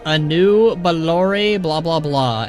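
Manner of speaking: slow, drawn-out elven greeting; Blood Elf voice actor. Retold or imitated. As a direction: stretch it out like a game voice line. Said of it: "female voice actor says".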